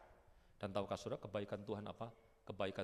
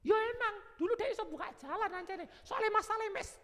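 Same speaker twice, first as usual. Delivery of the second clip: high, exasperated Javanese comeback; exasperated retort; 7 dB louder than usual. Pretend. Believer retorting to his friend, high and exasperated. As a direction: snap back at the advice.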